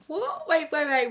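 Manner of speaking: high-pitched shocked "what", then rapid protest; startled disbelief. Retold or imitated. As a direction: reenact being caught completely off guard. Retold shock at being called in.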